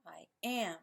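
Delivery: I am with weight on AM; light I, leaning into AM; stress on AM. Shown as a demonstration. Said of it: "the second syllable".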